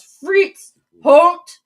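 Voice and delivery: high, loud command shouted after a dog; imitated yell. Neighbor calling her runaway dachshund to halt, imitated yell. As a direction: yell it sharply, imitating the woman. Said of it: "she comes out and she yells".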